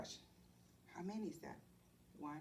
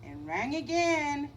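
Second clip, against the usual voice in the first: loud, high and slow; storyteller's big reveal; dramatic announcement; 8 semitones higher, 12 dB louder than usual. A narrator's reading. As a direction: build the suspense as it rings again. Dramatic build before the last visitor.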